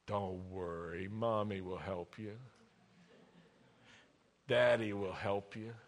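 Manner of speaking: put-on low, soft reassurance to a child; soft parent comfort. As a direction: soothe a scared little one.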